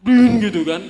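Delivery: loud imitated horn honk; preacher mimics his bike horn, loud; horn sound. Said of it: "klaksonnya bunyinya brin".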